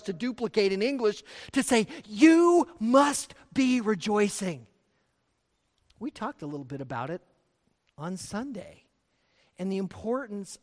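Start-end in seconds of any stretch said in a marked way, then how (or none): 2.0-4.7 slow, loud "You must be rejoicing", each word weighted; emphatic command. Shown as a demonstration. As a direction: lean hard and slowly on "You must". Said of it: "Here's this emphatic element".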